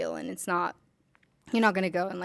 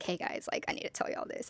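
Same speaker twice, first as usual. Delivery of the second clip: whispered announcement; 8 dB quieter than usual. Retold or imitated. Mock announcement to friends.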